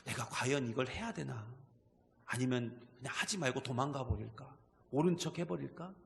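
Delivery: low, soft, hesitant self-questioning; wavering inner doubt. Acted. Preacher voicing Abraham's doubts on the mountain road, low and wavering. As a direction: voice a troubled man's private thoughts, quietly. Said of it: "많이 흔들렸겠죠".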